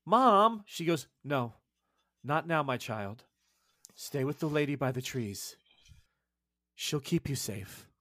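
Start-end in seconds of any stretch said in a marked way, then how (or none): 0.0-0.6 a higher, louder cry of "Mom"; child calling out. Acted. Little girl calling to her mother, higher and louder. 1.2-7.9 gentle mother. Acted. Mother soothing her child.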